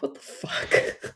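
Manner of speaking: breathy disbelieving whisper under the breath; stunned whisper. Retold or imitated. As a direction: whisper it, slowly and shocked. Stunned reaction to rude question.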